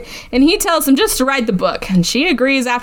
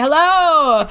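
put-on a higher, drunk call into the dark house; 4 semitones higher than usual. Drunk Hart calling around the house for wine, higher. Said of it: "he's just super drunk".

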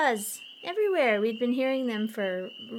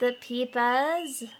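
put-on New England accent; 2 semitones higher, 3 dB louder, much slower than usual. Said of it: "my terrible New England accent".

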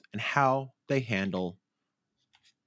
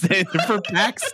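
laughing through the correction, pax; 10 semitones higher, 4 dB louder than usual. Real, not acted. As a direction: say pax plainly while cracking up. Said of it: "I was trying to do a Boston accent".

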